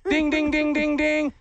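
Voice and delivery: high, even winner's bell; imitated bell. Host confirms the number one reason, imitated bell. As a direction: ring out high dings on one pitch.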